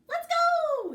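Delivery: very high squeaky ant; tiny ant voice. A narrator's reading. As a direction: squeak impatiently at Sarah. The ant calls Sarah down.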